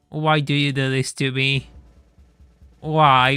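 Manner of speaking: usual speaking voice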